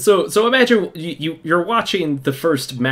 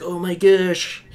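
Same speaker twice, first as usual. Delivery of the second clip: put-on amazed kids; 7 semitones higher than usual. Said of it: "they're like".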